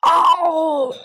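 beast's furious roar; angry roar. Acted. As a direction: roar out like a monster. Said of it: "生气地大吼起来".

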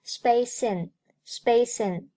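"space in" joined, s carried into "in"; linked pronunciation. Shown as a demonstration. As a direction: slowly link "space in" as one word. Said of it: "Space和in连读".

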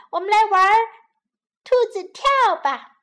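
put-on high, loud gleeful child; excited. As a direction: bounce with excitement. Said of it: "兴奋地蹦蹦跳跳".

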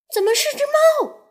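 shocked to find a cat aboard; astonished. Acted. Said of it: "飞鱼们大吃一惊". (大吃一惊地尖叫)

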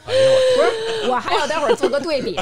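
high laughing protest. Real, not acted. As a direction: talk through the giggles.

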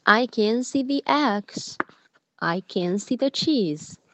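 slow, clear sing-song reading; reading to a toddler. Acted. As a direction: read it out to a small child. Reads the full recording again.

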